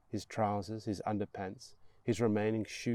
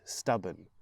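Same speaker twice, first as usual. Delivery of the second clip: ordinary delivery, nothing marked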